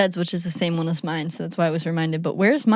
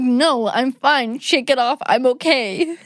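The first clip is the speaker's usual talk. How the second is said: imitating tough kid insisting fine; 5 semitones higher, 4 dB louder, slower than usual. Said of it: "And I'm like".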